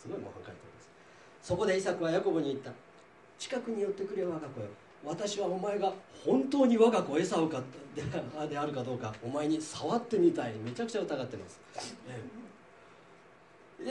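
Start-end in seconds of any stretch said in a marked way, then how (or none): 1.4-10.5 measured Bible reading voice with light character colour; scripture reading. Acted. Teacher reading Genesis to the class, measured reading voice. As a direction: read the passage out to the class.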